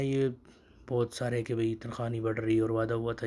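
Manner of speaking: normal talking voice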